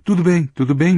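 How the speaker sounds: mild amused cry. A narrator's reading. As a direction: give in with a lightly called-out protest. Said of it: "gritou Rich, também rindo". (grito leve de quem se rende)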